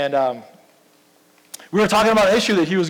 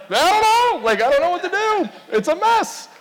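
high-pitched, hammed-up overwhelmed wail; mock helplessness; 9 semitones higher than usual. Acting out overwhelmed people handing messes to God.

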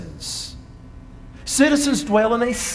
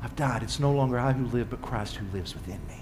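much softer, lower, intimate aside; hushed confession; 10 semitones lower, 9 dB quieter, faster than usual. Real, not acted. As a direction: drop to a near-murmur, personal.

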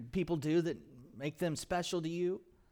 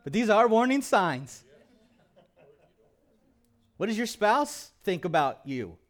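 loud, high, ringing sermon voice; full-force preaching; 5 semitones higher, 10 dB louder than usual. Acted. Pastor preaching hard to the church, loud and raised. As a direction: preach it out with fire.

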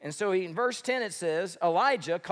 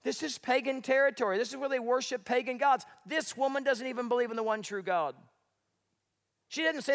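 ordinary talk

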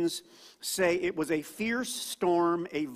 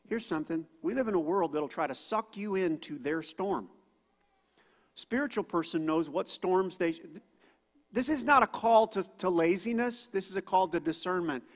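usual speaking voice

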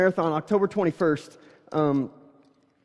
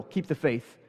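mocking literal take; 4 semitones lower, 7 dB quieter than usual. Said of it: "take that way too literal".

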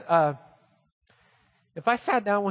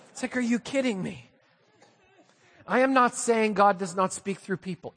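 ordinary talk, nothing marked

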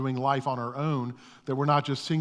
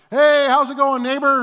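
high, loud cheery greeting; cheery hello; 12 semitones higher, 11 dB louder than usual. Retold or imitated. Greeting the neighbor over the yard, loud and cheery. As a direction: call out brightly to the neighbor.

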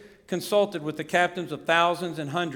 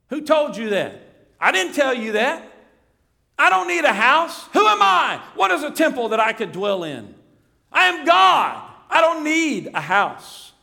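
much higher, louder rebuke; God rebuking; 7 semitones higher, 6 dB louder than usual. Pretend. Preacher voicing God rebuking David, loud and high. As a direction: thunder it down as God correcting a boy.

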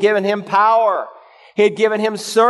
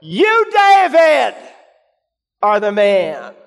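emphatic accusing shout; much slower than usual. Acted. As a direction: point the finger and shout you, David. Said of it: "it's emphatic in the original".

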